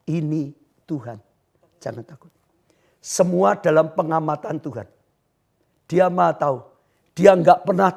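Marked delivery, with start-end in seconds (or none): 0.1-2.3 soft, low and hushed, fading to a whisper; calm reassurance. Acted. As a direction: soothe the frightened ones gently. Jesus speaks to the listeners. (suara lembut menenangkan)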